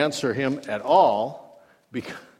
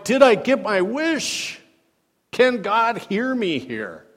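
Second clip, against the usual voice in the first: higher, slower, searching; anxious wondering; 8 semitones higher, 3 dB louder, slower than usual. Acted. Preacher voicing Jonah's fear in the fish, higher and slower.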